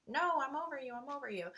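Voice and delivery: soft, high-pitched mock denial; high and hushed, acted-out insistence; dismissing inner voice. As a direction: brush it off in a small, lifted voice. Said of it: "that voice that was talking to me".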